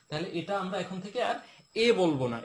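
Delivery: ordinary talk, nothing marked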